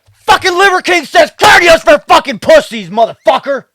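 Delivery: full-volume, high-pitched swearing outburst; demonstrated angry shout. Shown as a demonstration. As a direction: yell the whole line with every word hit hard. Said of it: "That's how I would have said it".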